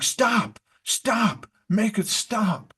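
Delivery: high, panicky pleading; Mickey Mouse pleading with the runaway brooms, high and panicked; panicky high cries. Retold or imitated. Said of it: "he's like".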